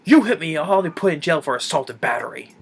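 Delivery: hammed-up indignant threat; crooked vet threatening the dad after being grabbed. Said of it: "he's like".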